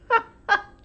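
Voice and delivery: sudden high laugh; burst of laughter. Real, not acted. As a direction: laugh out loud in surprise. Laughing at camera moved.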